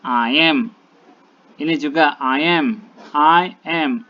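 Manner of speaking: I am said loud and firm, each word punched; stressed I am. Shown as a demonstration. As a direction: hit "I am" hard to show the predicate. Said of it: "M-nya itu juga termasuk kata predikat".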